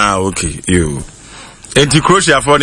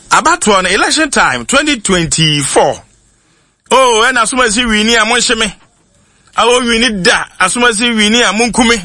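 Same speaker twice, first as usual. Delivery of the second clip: excited high-pitched quoting; 7 semitones higher than usual. Retold or imitated. Quoting election predictions.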